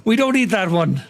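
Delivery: high, frantic mock warning; worked-up imitation of own panic; excitable self-quote. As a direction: act out urgently stopping yourself mid-attack. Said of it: "I'm a very excitable person".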